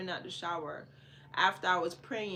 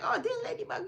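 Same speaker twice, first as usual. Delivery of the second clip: dismissive mock; 14 semitones higher, faster than usual. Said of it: "many people see that like".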